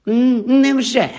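дуже високо, протяжно, із запинкою; спробуй поглузувати, затнувшись на початку; невдале глузування. A narrator's reading. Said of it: "спробував глузувати Мелфой". (удавана глузливість)

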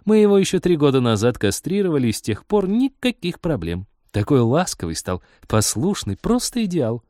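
high, lilting cheerful chatter; amused woman. A narrator's reading. Girl proudly telling pilot about her neutered cat, amused. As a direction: gush brightly about a beloved pet. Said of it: "рассмеялась Рит".

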